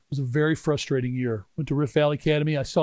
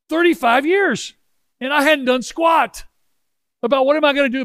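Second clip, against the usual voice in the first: exasperated emphasis; 10 semitones higher, 4 dB louder than usual. Real, not acted. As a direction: hammer home how long it has been. Lamenting years of inaction.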